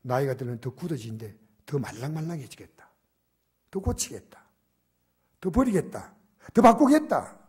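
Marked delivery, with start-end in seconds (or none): 1.7-7.4 raised pitch, growing to a loud declaration; building resolve. Acted. Pastor voices a new year vow, building resolve. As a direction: build each vow to a near shout.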